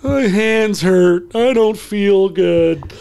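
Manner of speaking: very slow, high, whining complaint; whiny tired player. Retold or imitated. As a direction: coach mimicking a worn-out player's whining. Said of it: "you know, like this".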